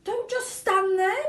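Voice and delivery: high, loud, slow scolding shriek; screaming mother. A narrator's reading. Mother screaming at her husband, high and loud. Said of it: "screamed at Mister Gloop".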